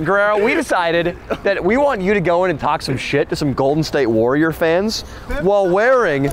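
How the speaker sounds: usual speaking voice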